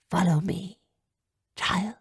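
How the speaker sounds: put-on soft old mage whisper. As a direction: whisper it gently. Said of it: "she whispered".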